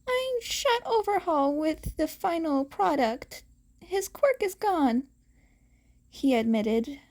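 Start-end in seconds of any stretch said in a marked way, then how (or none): 0.1-5.0 put-on lightly raised nervous teen voice; a hint of a nervous boy confessing; young hero. A narrator's reading. Izuku admitting to Aizawa, nervous and higher.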